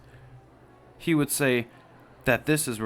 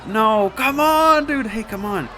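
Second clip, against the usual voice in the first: higher, louder, slow begging cry; desperate plea; 10 semitones higher, 8 dB louder, slower than usual. Acted. Friend begs Schlepp to speak, loud and high. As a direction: drag the plea out in despair.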